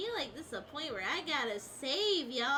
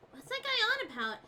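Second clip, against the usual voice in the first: normal talking voice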